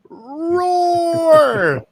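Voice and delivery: put-on long, high stretched baby roar; toddler roar. Cohost imagining the NFT sound, drawn-out high roar. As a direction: draw out a high kiddie roar.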